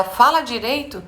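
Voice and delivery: mock a parent's correction; scolding parent. Parent turns to the child, mock scolding. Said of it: "Quando você vira pra ela".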